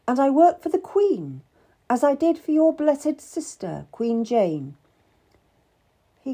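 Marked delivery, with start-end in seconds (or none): 0.1-4.8 high, proudly raised voice; name your royal service with pride. A narrator's reading. Cat tells Seymour whom she serves, proud. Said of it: "I said proudly".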